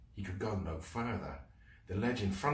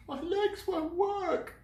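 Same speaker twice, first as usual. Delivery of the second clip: high, slow tearful wail; wail, too scared to move; slower than usual. Acted. Sylvie wailing.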